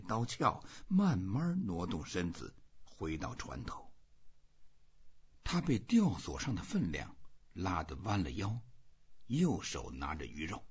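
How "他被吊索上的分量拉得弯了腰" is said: usual speaking voice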